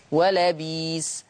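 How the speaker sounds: "ولبيس" slowly as wa-la-biis; hamza turned to yaa. Shown as a demonstration. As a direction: hold the long ii of "ولبيس". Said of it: "هكذا".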